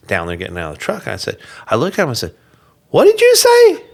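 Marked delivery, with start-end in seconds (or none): 2.9-3.8 high, loud disbelieving demand; incredulous retold shout. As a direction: fire the question back, shocked and loud. Retold confronting the man.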